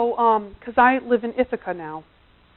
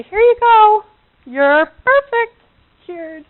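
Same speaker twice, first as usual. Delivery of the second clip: magic fix; 10 semitones higher, 11 dB louder, slower than usual. Pretend. Pretend handing over magic wand.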